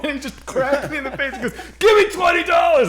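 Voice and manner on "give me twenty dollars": higher and louder shouted demand; hammed-up mugger shout; mock yelled demand. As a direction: bark it like a robber mid-punch. Mugger yells after punching.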